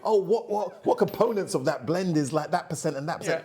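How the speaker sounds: high, laughing insistence; mock pushy fan. Fan pressing the master blender, high and pushy. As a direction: press for the recipe.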